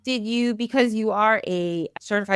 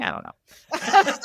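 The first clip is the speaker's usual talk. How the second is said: giggling through the words; laughing; 10 semitones higher, slower than usual. Real, not acted. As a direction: laugh at your own mix-up. Laughing at her own slip.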